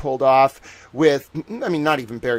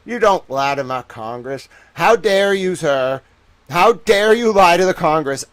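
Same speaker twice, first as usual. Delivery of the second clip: loud, higher, hammed-up outraged old-time newsman; nineteen thirties reporter; 4 semitones higher, 6 dB louder than usual. Newsman outraged that someone lied to Congress, loud mock fury. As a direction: bluster like a scandalized thirties reporter. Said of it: "nineteen thirties news reporter towel".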